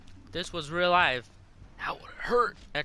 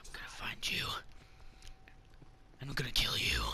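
whispered threat; 4 semitones lower, 7 dB quieter, faster than usual. Pretend. Whispered threat to enemy player.

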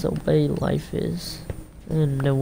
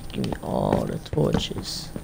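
much lower, slow greedy growl; mock deep greedy voice; 3 dB quieter, slower than usual. Player grabbing torches in a mock greedy voice. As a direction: play a greedy goblin hoarding loot.

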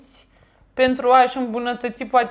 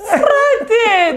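high, loud laughing cry; laughing outburst; 10 semitones higher, 5 dB louder than usual. Real, not acted. Daughter cracks up at her mother, loud laughing cry. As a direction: explode into laughter at mom's line.

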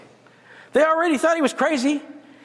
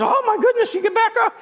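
shocked exclamation; 11 semitones higher than usual. Retold or imitated. Natural reaction to shared insult.

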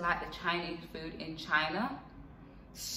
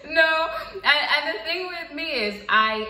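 laughing; 9 semitones higher, 8 dB louder, slower than usual. Real, not acted. Still laughing after seafood remark.

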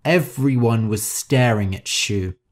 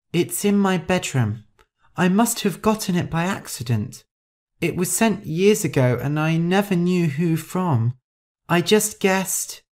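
timid confession; 6 semitones higher than usual. Acted. Shu admitting to Rosa she has the painting, timid. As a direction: confess meekly in a higher voice.

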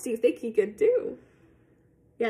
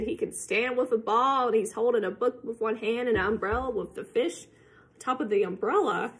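usual speaking voice

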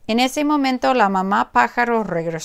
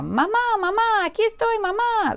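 high calling chick voice; high bird voice calling out; calling little bird; 13 semitones higher than usual. Acted. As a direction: run toward her crying mama. Bird calls to the big thing.

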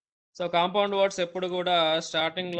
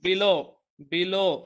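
below drawn out, low pressed louder; slow stress on LOW; 4 dB louder, much slower than usual. Shown as a demonstration. Teacher drilling students on below, stressing low. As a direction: stretch below and lean on low. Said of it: "లో కి starting letter దగ్గర stress".

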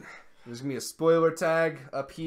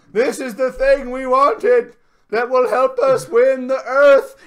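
slow, booming, much higher villain; grandiose villain; 9 semitones higher, 12 dB louder, slower than usual. Retold or imitated. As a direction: declaim it like a movie villain. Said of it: "he's like".

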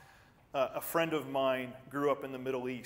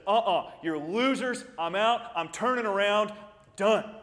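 loud, high scornful brush-off; dismissive quit; 6 semitones higher, 9 dB louder than usual. Pretend. Preacher imagining Jesus walking out, loud scornful dismissal. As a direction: toss them off and walk away.

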